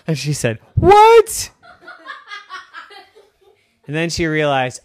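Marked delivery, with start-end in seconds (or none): 0.7-1.5 very high, loud shocked yelp; startled what. Acted. Girl shocked her toys talked, startled yelp. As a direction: yelp in disbelief.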